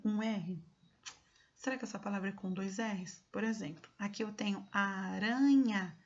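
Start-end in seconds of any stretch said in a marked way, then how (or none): 4.7-6.0 ARANHA drawn out with a light tapped R; single-R sound. Shown as a demonstration. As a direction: stretch out aranha, tapping the single R. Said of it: "Será que aranha é com R".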